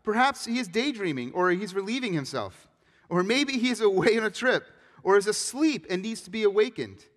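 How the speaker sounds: holy sarcasm, loud and high; mocking. A narrator's reading. Elijah taunting Baal's worshippers about their god, mocking sarcasm. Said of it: "Elijah began mocking them".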